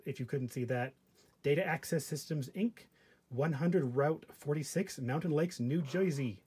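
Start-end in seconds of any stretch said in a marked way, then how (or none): 5.6-6.4 mock New Jersey accent, "Jersey" as "Joisey". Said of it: "did a stupid New Jersey accent".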